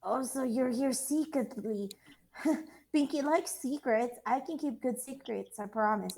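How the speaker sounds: put-on high, eager, childlike sweet voice; cute Pinky. Pinky pestering the goblin for answers, high childlike. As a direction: play it innocent and eager to please.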